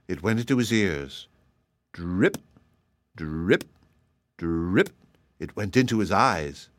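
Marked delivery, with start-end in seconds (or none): 1.9-4.9 low drip that flicks up at the end; dripping sound effect. A narrator's reading. Storyteller voicing butter melting off a hat, low dripping. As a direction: imitate slow, separate drips of melting butter.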